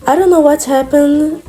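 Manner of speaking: ordinary talk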